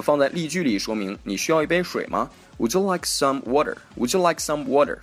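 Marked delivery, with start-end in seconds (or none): 2.6-5.0 American "water", t voiced and r pronounced; American accent. Shown as a demonstration. Teacher gives an example sentence about water, American accent. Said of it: "如果用英式发音读的就是".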